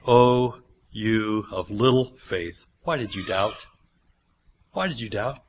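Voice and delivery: a lightly voiced tender reproach; gentle rebuke. Pastor voices Jesus gently chiding Peter, soft and low.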